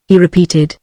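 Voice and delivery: plain reading voice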